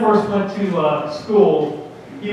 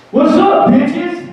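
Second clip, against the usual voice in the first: loud, high-pitched cocky shout; brash, pitched-up teen hello; cocky greeting; 11 semitones higher, 4 dB louder, much slower than usual. Acted. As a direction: call it out like a swaggering teen. Son struts in, greeting parents.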